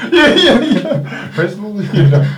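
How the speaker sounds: laughing through the words; real giggling reply. Real, not acted. Laughing at joke about church.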